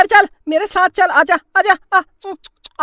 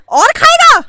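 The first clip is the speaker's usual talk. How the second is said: mother punishing her son; angry mother; 9 semitones higher than usual. Acted. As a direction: hit each word like a slap.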